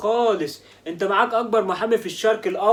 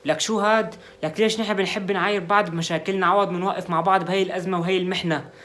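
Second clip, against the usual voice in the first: ordinary talk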